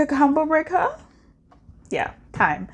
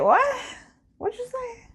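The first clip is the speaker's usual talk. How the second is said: high squeaky confused what; mock confused what; 13 semitones higher, 4 dB quieter than usual. Imitating someone who can't hear people yelling, high and puzzled. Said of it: "you're like".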